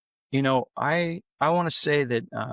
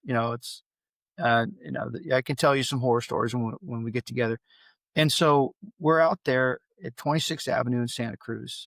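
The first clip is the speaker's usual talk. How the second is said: ordinary talk, nothing marked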